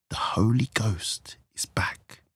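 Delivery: slow, hushed revelation; awed whisper. Acted. Friend reveals the ghost's return, whispering. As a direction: whisper it slowly as a secret. Ominous news confided to the hostess.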